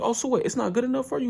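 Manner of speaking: squeaky wounded pride; offended coach voice. Retold or imitated. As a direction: coach taking offense at Ippo, high huffy. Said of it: "He's like".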